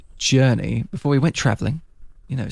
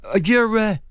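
lightly put-on Italian-American mobster accent; a touch of mobster swagger, pitched up; hint of a Gotham mob boss accent; Falcone's accent; 10 semitones higher than usual. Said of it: "in his unbelievable accent".

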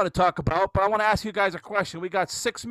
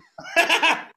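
laughs; 12 semitones higher, 8 dB louder than usual. Real, not acted. Pen flies away again.

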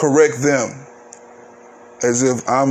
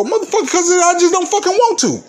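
shrill, much higher outburst in a put-on voice; blunt knee-jerk reply; faster than usual. Grown person snapping at someone asking why not, knee-jerk outburst. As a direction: snap back without thinking. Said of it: "a lot of times people go".

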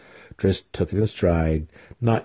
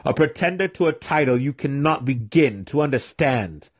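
higher-pitched scornful accusation; accusing cry; 6 semitones higher than usual. A narrator's reading. Mentor denouncing his student's claim, crying out. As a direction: denounce him with raised pitch, no shouting. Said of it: "Zack cried".

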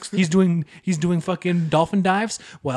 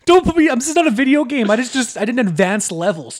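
much higher, loud exasperation; exasperated outburst; 9 semitones higher, 5 dB louder than usual. Acted. Fed-up caller ranting at tech support, high and loud. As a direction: blow up at the call.

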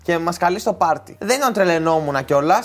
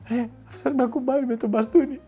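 high-pitched, softer complaint; Βουγάνης; 7 semitones higher, 4 dB quieter than usual. Retold or imitated. Friend complains about the officer's baton, high mock protest. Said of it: "Και ο Βουγάνης λέει".

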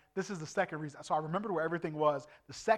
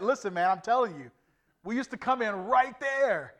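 louder, higher retelling of an argument; insistent; 5 semitones higher, 6 dB louder than usual. Retold or imitated. Preacher replays arguing with a doubting guide, loud insistence. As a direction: insist on it, raising your voice. Insists after being told he's wrong.

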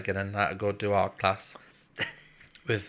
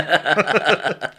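louder, laughing through the line; laughing loudly; 6 semitones higher, 10 dB louder than usual. Real, not acted. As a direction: laugh it out, delighted. Imagined remark to the Queen.

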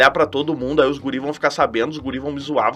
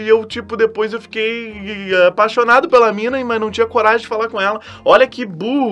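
normal talking voice

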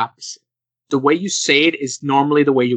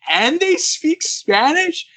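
much higher, slow disbelieving question; stunned, stretched-out repeat; incredulous; 14 semitones higher, much slower than usual. Real, not acted. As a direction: echo it back in utter amazement. Repeating her words in disbelief.

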